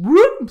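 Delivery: quick rising cartoon whoop; slipping whoop. Comic imagining a banana peel slip, cartoon whoop. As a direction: whoop like a pratfall.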